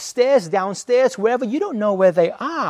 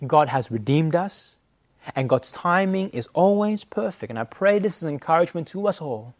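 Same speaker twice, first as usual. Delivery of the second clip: normal talking voice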